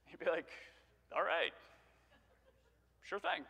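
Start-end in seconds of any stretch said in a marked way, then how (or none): 1.1-3.4 underwhelmed reply. Acted.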